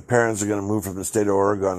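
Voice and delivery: usual speaking voice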